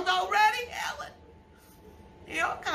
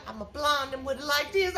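ordinary talk

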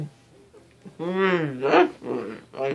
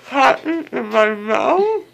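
laughing while crying; 9 semitones higher, 4 dB louder than usual. Real, not acted. Groggy after surgery, laughing-crying.

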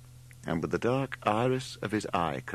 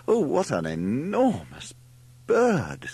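high, louder, drawn-out amazement; exclaiming; 6 semitones higher, 5 dB louder, slower than usual. A narrator's reading. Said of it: "exclaimed Margot".

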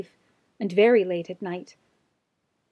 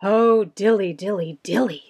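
sighing cat; 3 semitones lower than usual. A narrator's reading. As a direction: sigh it out, hungry and glum. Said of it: "sighed Simpkin".

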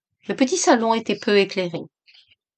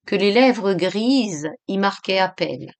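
ordinary delivery, nothing marked

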